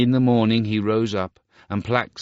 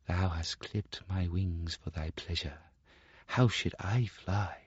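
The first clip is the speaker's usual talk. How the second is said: much softer, sorrowful reproach; reproachful linnet; 8 dB quieter than usual. Acted. Linnet reproaches the Star Child, hushed. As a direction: answer him hushed and hurt.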